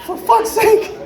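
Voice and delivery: high, exasperated mock rant; exasperated outburst. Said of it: "I was like".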